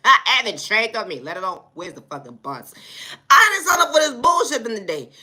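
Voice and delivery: ordinary talk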